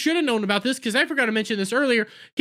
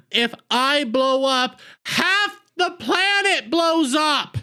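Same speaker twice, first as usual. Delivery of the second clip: mock dramatic warning; 4 semitones higher, slower than usual. Doctor warns he is a bomb.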